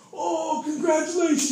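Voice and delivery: high, loud, sarcastic "congratulations" with a hit; mock jealous congratulations. Homeless man mocking a new father, high and loud. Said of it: "He starts smacking him in the face".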